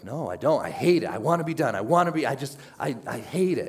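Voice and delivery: imitating a desperate addict pleading; protesting addict. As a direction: insist you hate it, voice climbing. Addict protesting in retold exchange.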